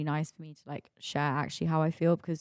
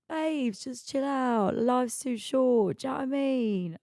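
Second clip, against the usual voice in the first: Essex cabbie; 7 semitones higher, slower than usual. Retold or imitated. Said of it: "this Essex guy".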